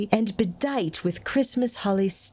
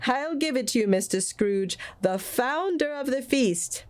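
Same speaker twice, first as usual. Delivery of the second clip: raised toast; 7 semitones higher than usual. A narrator's reading. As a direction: raise a glass solemnly. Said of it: "said Bob".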